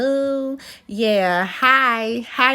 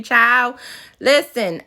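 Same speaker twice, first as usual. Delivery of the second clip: normal talking voice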